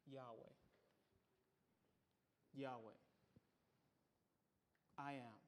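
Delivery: very low, soft and solemn; reverent, quiet low utterance; hushed reverence. Acted. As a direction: let the holy name drop almost to a murmur. Solemn reveal of God's name.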